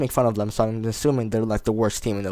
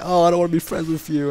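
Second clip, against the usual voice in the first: annoyed friend; 5 semitones higher than usual. Retold or imitated. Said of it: "they're like".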